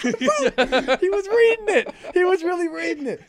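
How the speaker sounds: high, loud, laughing words; laughing protest. Real, not acted. Cohost cracking up at his friend's joke, laughing.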